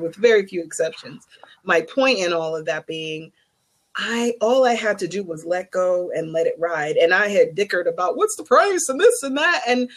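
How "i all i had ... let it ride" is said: normal talking voice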